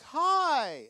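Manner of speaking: high, loud, sing-song hi; chirpy publisher hi. Retold or imitated. Publisher calling the author, chirping a high loud hi. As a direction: greet like an overeager publisher. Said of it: "she was like".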